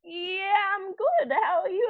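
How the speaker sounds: a shy guy answering, voiced up high; imitating awkward Tim. Awkward Tim replies to her, mock high voice. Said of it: "So he was like".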